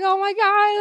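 high, cracking, overcome with tears; reliving a sob on stage; crying out in disbelief; tearful oh my god. Retold or imitated. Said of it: "so I was crying more".

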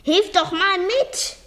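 high, loud, slow plea for help; demanding shout. A narrator's reading. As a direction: call for help while straining. Straining man demands help. (laut und langgezogen gefordert)

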